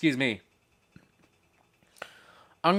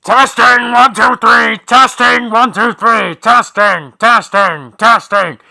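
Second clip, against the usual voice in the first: growled mic check; 8 semitones higher, 14 dB louder, slower than usual. Pretend. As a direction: roar it like a death metal singer warming up. Growled mic check before metal cover.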